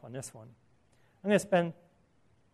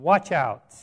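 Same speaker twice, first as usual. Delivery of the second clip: WATCH OUT hit hard and loud; a forceful warning, both words of WATCH OUT stressed; emphatic command; 7 dB louder than usual. Acted. Jesus warning the crowd about greed, WATCH OUT in loud emphatic command. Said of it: "It's a command with emphasis".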